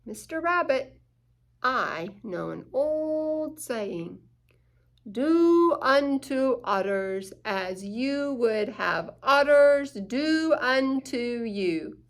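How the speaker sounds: put-on slow, measured old saying; a lightly voiced wise owl. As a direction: owl advising the worried rabbit, slowly. Wise owl gives advice.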